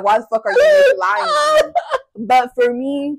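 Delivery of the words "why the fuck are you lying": high, loud, laughing mock outrage; voicing the dog. Dog calling out owner's lie, mock outrage. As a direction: play an indignant dog through laughter. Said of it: "The dog's like".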